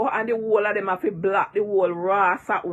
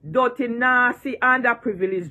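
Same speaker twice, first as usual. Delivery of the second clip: normal talking voice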